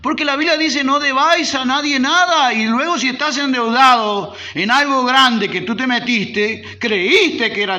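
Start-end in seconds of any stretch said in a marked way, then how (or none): 0.0-5.5 loud, high preaching voice. Acted. Preacher exhorting the congregation, loud and high. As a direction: proclaim it to the congregation.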